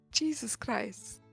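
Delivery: ordinary talk, nothing marked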